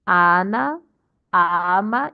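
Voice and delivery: 'Ana ama' with long opening vowels; stress on first syllables. Shown as a demonstration. Teacher reading the text to class, 'Ana ama' stressed on first syllables. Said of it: "That's how to read correctly".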